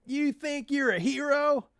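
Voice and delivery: high sneering thug taunt; mock his heroics. Thug sneering at DJ's heroics, high and mocking.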